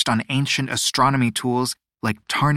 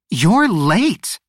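teasing mother; 6 semitones higher, 5 dB louder than usual. A narrator's reading. Mother teasing her son.

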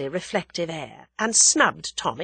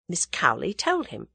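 usual speaking voice

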